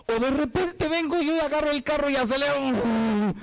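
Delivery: high-pitched, fast, panicky outburst; frantic reenactment. Acted. Preacher acting out a driver's sudden crash and regret, frantic. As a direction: act it out in a high, urgent rush.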